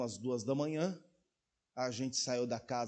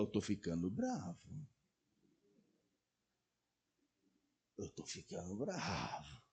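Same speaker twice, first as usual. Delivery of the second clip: quiet inner voice; 6 semitones lower, 11 dB quieter than usual. Acted. Preacher voices inner warning.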